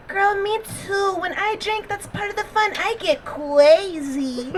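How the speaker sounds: mock baby talk, slow on the last words; put-on girly voice. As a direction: mimic a grown woman putting on a cutesy voice. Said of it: "Why are you talking like that".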